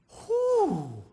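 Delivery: high whistled whew sliding down; big whew. Real, not acted. Pastor recovering after reenacting the fight, relieved whew. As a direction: let out a falling whew after the outburst. Exhales after acted outburst.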